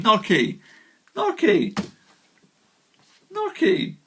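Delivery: testing "gnocchi" pronunciation for the Italian viewers; trying the pronunciation. Shown as a demonstration. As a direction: keep reaching for the right "gnocchi", pitch rising each try. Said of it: "don't tell me I'm pronouncing gnocchi incorrectly".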